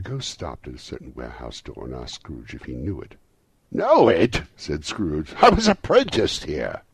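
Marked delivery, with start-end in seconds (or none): 3.7-4.4 high, loud burst of recognition; excited exclamation. A narrator's reading. Scrooge recognising the warehouse door, excited cry. Scrooge thrilled at old workplace. 5.4-6.8 very high, loud excited cry; thrilled exclamation. A narrator's reading. Scrooge telling the ghost he was apprenticed here, thrilled cry.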